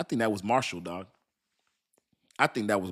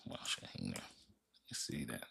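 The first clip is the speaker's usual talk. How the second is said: muttered to himself; 21 dB quieter than usual. Real, not acted. Muttering to himself while reading.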